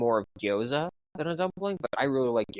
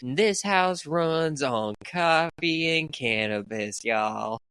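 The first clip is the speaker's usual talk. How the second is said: put-on slow, high Southern lady accent; Southern older lady; 6 semitones higher, much slower than usual. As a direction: drawl it like a sweet Southern grandma. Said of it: "trying to do a very Southern older lady".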